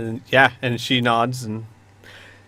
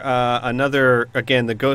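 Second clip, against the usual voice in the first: ordinary talk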